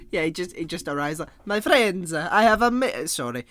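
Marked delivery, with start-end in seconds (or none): 1.4-3.4 loud, higher hammed-up Italian accent; theatrical Italian-accent proclamation; mock Italian accent. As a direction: announce grandly in a put-on Italian accent. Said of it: "when I go for an Italian".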